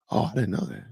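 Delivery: normal talking voice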